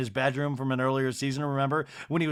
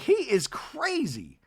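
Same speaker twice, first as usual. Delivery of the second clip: mock disbelief; 9 semitones higher, slower than usual. Acts out Madison's shocked verdict.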